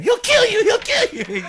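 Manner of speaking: very high, loud shrieking; Hugh yelling threats. Retold or imitated. Storyteller imitates friend jumping up and down at the attackers, screaming. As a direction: scream it like a frantic bystander. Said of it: "saying, yelling at them".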